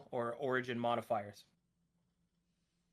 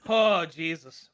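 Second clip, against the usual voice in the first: normal talking voice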